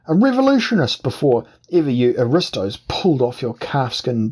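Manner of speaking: indignant outburst. Acted. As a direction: bluster hard to cover the lie. Indignant outburst against insult.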